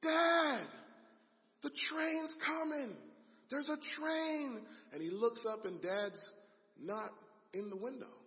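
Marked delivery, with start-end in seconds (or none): 0.0-0.7 high calling shout for dad; yelling. Acted. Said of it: "he starts yelling". 1.6-4.7 high, slow alarmed shout; yelling. Acted. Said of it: "he starts yelling".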